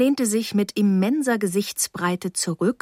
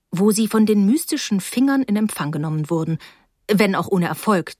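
ordinary delivery, nothing marked

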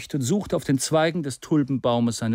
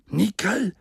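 higher, startled question; anxious exclamation; 6 semitones higher than usual. A narrator's reading. Ardan hears who it is, anxiously surprised. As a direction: call the name in alarmed disbelief. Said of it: "rief Michel Ardan, dem sich das Herz zusammenschnürte".

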